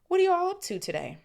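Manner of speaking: sing-song mother asking; cheery mum. Acted. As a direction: call it out brightly. First reading, Mrs Weasley.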